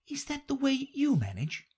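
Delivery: put-on high, girlish question; curious Alice. A narrator's reading. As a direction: ask it brightly as a young girl. Alice asking the Hatter.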